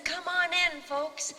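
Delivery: loud, high booming welcome; hammed-up hearty invitation; 1 loud host welcome. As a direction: wave the crowd in like a showman. [name] inviting crowd in.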